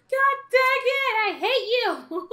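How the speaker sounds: loud, high cry of frustration; frustrated outburst. Real, not acted. Gameplay goes wrong.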